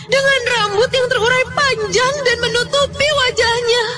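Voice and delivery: high, tearful horror narration; terrified. Acted. Narrator sees a long-haired woman by the bed, terrified. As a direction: tell it in rising panic, close to crying.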